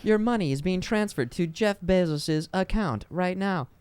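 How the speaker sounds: high robotic assistant voice, lightly done; mock Alexa voice. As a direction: play a chirpy smart speaker. Pretending to be Alexa.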